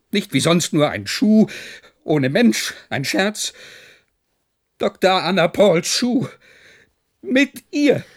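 rising agitation. Acted. General telling Phil about Anna's shoe, growing agitated. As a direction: build from upset to near shouting.

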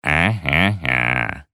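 low, drawn-out drone; muffled voice heard through a door; droning mumble. A narrator's reading. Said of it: "забубнил".

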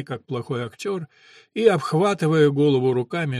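usual speaking voice

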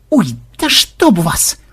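drawn-out yelp, slowed; stretched pained howl. A narrator's reading. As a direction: wail it as the arrow hits. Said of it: "взвыл программист".

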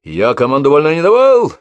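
furious bark of an order; yelling. A narrator's reading. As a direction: yell the order at the ranks. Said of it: "заорал".

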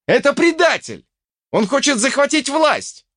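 high, urgent accusation; denouncing shout. A narrator's reading. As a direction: cry treason to the whole square. Denouncing the traitor to fighters. (обличительный крик)

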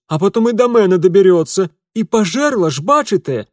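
high, loud breathless peasant cook; shrill excited woman. Acted. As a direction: spin the scary tale shrilly. Cook tells monster tale.

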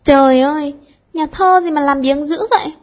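loud, high mock complaint; exclaiming loudly. A narrator's reading. Cúc Hương cries out at the lazy poet, loud and teasing. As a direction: cry out in teasing protest. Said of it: "Cúc Hương kêu lên".

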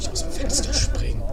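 ordinary talk, nothing marked

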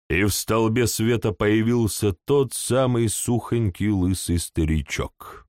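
usual speaking voice

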